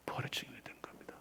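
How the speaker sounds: fast breathy whisper. Real, not acted. Pastor echoing that Peter became a witness, whispered. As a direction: rush it out in a whisper.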